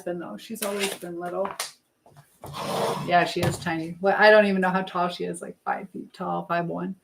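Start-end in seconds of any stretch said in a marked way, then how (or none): none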